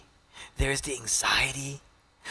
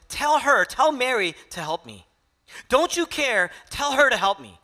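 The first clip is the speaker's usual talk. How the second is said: higher and louder, exasperated complaint; frustrated Martha; 8 semitones higher, 5 dB louder than usual. Retold or imitated. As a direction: voice Martha fed up and pleading for help. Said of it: "In her frustration".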